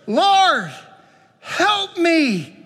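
high, strained cry for help; a mother pleading with Jesus, desperate and high; desperate plea. Acted. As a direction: beg him like a frantic mother. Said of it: "hear the desperation in her voice".

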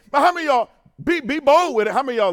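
loud, high call to the crowd; preacher's loud challenge to the pews. Real, not acted. Preacher calling on congregation.

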